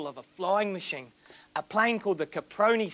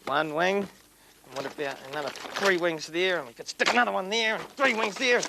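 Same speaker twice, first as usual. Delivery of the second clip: ordinary delivery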